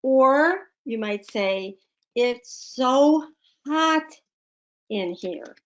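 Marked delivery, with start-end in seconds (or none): 2.1-5.5 mock complaint about the heat; emphatic exclamation. Shown as a demonstration. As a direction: lean hard on "so hot". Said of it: "Exclamation point".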